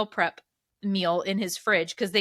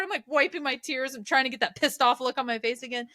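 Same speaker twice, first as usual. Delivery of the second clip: ordinary talk, nothing marked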